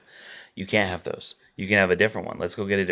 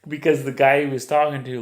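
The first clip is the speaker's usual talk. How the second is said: ordinary talk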